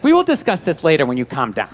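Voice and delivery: mock-composed wife talking down to him, through a laugh; prim calm wife. Wife's calm lofty reply.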